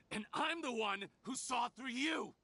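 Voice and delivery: gloating, rising accusation; triumphant accusation. Acted. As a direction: throw the reveal in their face. Villain triumphantly unmasks the other.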